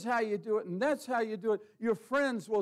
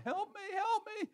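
squeaky, much higher mock panic; panicked plea; 14 semitones higher than usual. Stranded driver begs God for help, in high mock panic. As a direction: whimper it like a helpless stranded driver.

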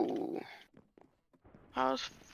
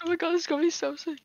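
excited; 7 semitones higher, 3 dB louder, faster than usual. Real, not acted. Hyped about the next fight.

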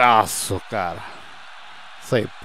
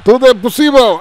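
"tudo é possível" much higher and louder second time; excited repeat; 13 semitones higher, 9 dB louder than usual. Real, not acted. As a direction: shout "possível" out. ("tudo é possível" gritado)

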